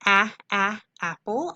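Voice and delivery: teacher modeling short a; short a sound. Shown as a demonstration. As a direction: isolate the short a, a, then say apple.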